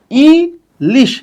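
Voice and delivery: "Ilish" very slowly, long ই, pause, then লিশ; split into syllables. Shown as a demonstration. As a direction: break "ilish" into two spaced beats. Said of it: "ইলিশ শব্দটাও কিন্তু দুই অক্ষরের". ("ইলিশ" ধীরে দুই ভাগে, ই... লিশ)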